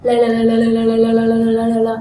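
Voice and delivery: loud rapid-fire "l, l, l"; loud tongue-loosening L drill. Shown as a demonstration. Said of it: "hãy làm cho lưỡi mình mềm hơn bằng cách".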